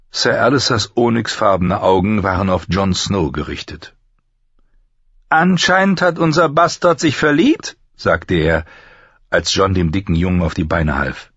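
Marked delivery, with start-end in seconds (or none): 5.3-7.8 high-pitched, sneering taunt; mocking. Master-at-arms taunts Jon for helping the boy, mocking. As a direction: tease cruelly in a raised voice.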